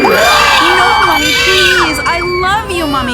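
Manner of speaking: high, loud panicked begging scream; terrified screaming child. Acted. Girl at the cliff edge begging her mother, screaming in terror. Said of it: "Sarah begins to scream".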